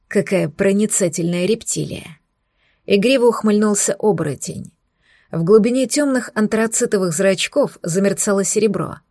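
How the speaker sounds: ordinary delivery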